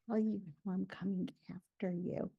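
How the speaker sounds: low, soft, menacing warning; creepy threat. Acted. Woman threatening her chatty friend, creepy and low. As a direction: say it softly, like a stalker. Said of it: "The way you say that!".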